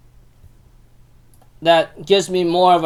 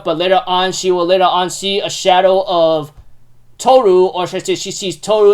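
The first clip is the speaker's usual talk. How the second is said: ordinary delivery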